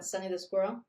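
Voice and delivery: ordinary delivery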